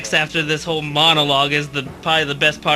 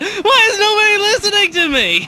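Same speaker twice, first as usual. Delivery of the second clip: whiny Mike impression; 13 semitones higher than usual. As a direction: beg to be heard like a sulking kid. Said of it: "He's, like, jumping on his seat".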